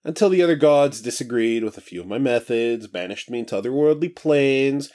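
high, breezy, carefree god; casual new voice. Acted. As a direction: stretch and chat like just woken. Cronus reveals plans.

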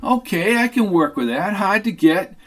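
lightly voiced smug Sid; flirty Sid. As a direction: relish the challenge of hard to get.

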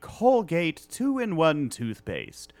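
slow announcer sponsor plug; booming commercial voiceover; ad read voice. Pretend. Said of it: "that's pretty good".

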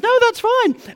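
high-pitched mock mum overruling; loud contradicting. Mum overruling dad about the kid, loud contradicting.

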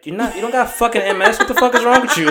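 mom's mock scolding. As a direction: scold your kid in disbelief, half joking. Acting mom's scolding reply.

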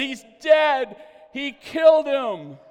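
high, very loud cry of alarm; hammed-up horrified shout, last words drawn out; shocked shouting. Doctor accuses Smith after the punch, shocked shouting. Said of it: "The doctor gasped and said".

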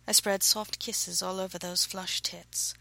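usual speaking voice

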